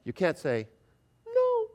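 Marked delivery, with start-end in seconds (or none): 1.3-1.7 tiny, very high thin squeak; squeaky no. Shown as a demonstration. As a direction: squeak it out feebly, without conviction. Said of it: "when we squeak it out".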